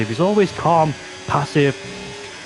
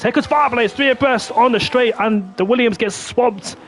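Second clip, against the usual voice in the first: excited; 9 semitones higher than usual. Real, not acted. Excited overtake call.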